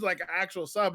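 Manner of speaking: ordinary talk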